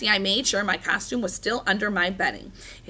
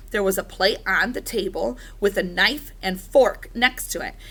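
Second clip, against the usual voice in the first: usual speaking voice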